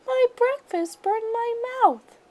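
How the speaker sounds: high, slow whiny cub voice; a little bear whimpering about its mouth; squeaky, slowed cub complaint; baby bear. A narrator's reading. Baby Bear complaining after burn.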